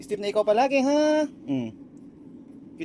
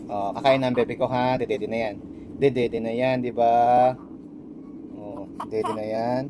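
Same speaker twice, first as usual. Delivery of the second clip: soothing; 8 semitones lower than usual. Real, not acted. Dad promising his baby a feed, low soothing.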